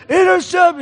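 high, loud scream of joy; excited scream. Retold or imitated. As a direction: yell it like a thrilled fan. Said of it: "I started screaming like a little girl".

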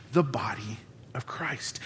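soft unvoiced whisper. Pastor whispering to the congregation about Christ's body. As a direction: breathe the words like a secret.

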